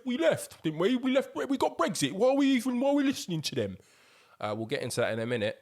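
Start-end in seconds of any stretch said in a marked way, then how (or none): 0.0-3.8 much higher, louder indignant mock voice; imitating Brexit voters. Mocking people angry about Rwanda ruling, indignant. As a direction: play the outraged leaver. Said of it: "a lot of people were like".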